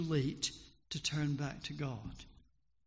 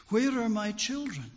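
higher, louder cry for his wife; desperate call; 5 semitones higher, 5 dB louder than usual. A narrator's reading. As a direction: shout into the darkness. Lost man calls in darkness.

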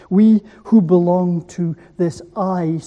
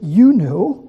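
"You" hit louder, "know" light; stress on you; 6 dB louder than usual. Shown as a demonstration. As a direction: push the weight onto "You". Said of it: "is emphatic in the Greek".

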